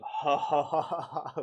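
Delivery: loud villain cackle; evil laughter. Retold or imitated. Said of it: "with an evil laughter".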